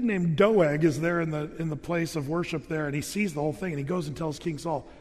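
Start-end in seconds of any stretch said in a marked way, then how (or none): none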